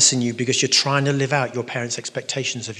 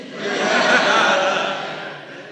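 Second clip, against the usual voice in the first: long high-pitched giggle; high laugh; 9 semitones higher than usual. Real, not acted. Preacher after his security camera joke, high drawn-out laugh.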